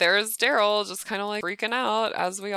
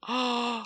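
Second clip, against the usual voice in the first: quick audible gasp, pitched up; startled gasp; 4 semitones higher than usual. Real, not acted. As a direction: suck in breath in surprise. Watching the fire spread.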